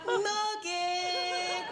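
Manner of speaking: high, drawn-out singsong call; calling a cat. Retold or imitated. Storyteller timidly summoning Morgan from the garage, cat-calling. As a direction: call out as if luring a cat to dinner. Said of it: "Like I was calling a cat for its dinner".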